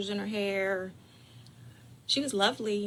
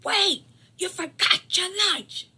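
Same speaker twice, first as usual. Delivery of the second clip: loud, high, slow call from the porch; high slow holler; mother calling out; 11 semitones higher, 8 dB louder than usual. Retold or imitated. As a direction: shout it down the street. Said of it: "on the porch and said".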